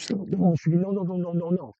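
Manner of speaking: low, soft string of no's; quiet, low mock protest; hushed refusal. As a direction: mutter the no's under your breath, imitating her. Wife's pleading refusal, retold.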